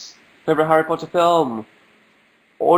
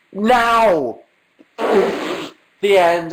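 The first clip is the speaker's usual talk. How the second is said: playful shout; 6 semitones higher, much slower than usual. Real, not acted. Answers when the show ends.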